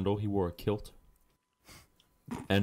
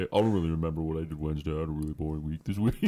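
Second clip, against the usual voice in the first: lightly voiced fast mumble; a quick hint of a bored friend rattling off his week; Anthony impression; faster than usual. Friend keeps mocking Anthony's week, a bit faster. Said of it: "This is my best impression of Anthony".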